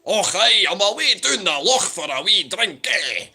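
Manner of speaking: high, loud hammed-up Scottish accent; mock Scottish impression. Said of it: "it usually sounds something like this".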